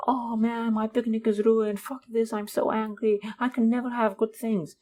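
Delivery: high, whining mock complaint; whiny negative voice. Comic mimics a negative person whose picnic got rained on, high whine. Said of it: "A negative person would be like".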